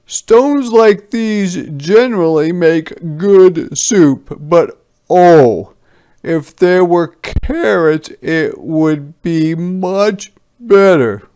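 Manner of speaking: sly soldier voice. A narrator's reading. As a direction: tempt them, savouring the better soup.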